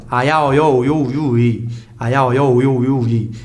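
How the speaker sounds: low, chant-like run of "A, ya, o, yo"; técnica de pronúncia. Shown as a demonstration. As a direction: recite "o, yo, u, yu" linked in an even rhythm. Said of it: "essa técnica aqui". ("A, ya, o, yo" em ladainha ritmada)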